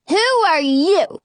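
put-on high child voice, rising at the end; demanding little boy. A narrator's reading. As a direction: demand it like a small, bossy boy. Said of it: "he demands".

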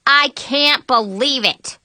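indignant, drawn-out exclamation; exclaiming in disbelief. A narrator's reading. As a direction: exclaim it, stretching the words. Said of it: "Violet exclaimed".

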